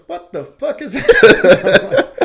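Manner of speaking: high, loud and slow, cracking up; sergeant's disbelief laughed out loud; laughing mock outrage. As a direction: drag the line out while laughing. Staff sergeant scoffs at his MOS.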